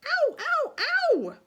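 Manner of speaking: very high, loud cries of pain; pained yelp. Acted. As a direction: yelp as the fish bites. Frog seized by the trout.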